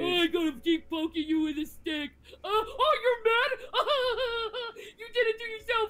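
put-on taunting voice with fake laughs; mocking harasser imitation. Streamer mimicking harassers poking her, mocking voice.

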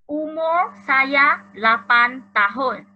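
slow, loud, spaced Malay sentence; slow clear reading. Shown as a demonstration. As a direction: read it slowly so pupils catch every word. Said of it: "老师会念是".

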